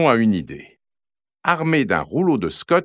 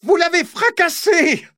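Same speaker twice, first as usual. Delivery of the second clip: much higher, shrill angry sister; furious yell. Acted. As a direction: scream the blame at them. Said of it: "hurle Mylène". (Mylène hurle, voix suraiguë et furieuse)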